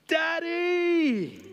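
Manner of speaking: put-on high, small child's wail of Daddy; child crying Daddy. Son calling out to his dad in distress. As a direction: cry out like a mucky little boy. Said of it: "he said the one word I didn't want to hear".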